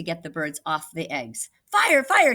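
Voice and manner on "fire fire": high, loud alarm call; crying fire. Acted. Cat yelling a fake alarm to scare the birds off, loud. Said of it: "he crawled".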